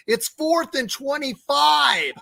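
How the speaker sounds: loud, high, building recap; excited play-by-play. Real, not acted. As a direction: call it like a live play.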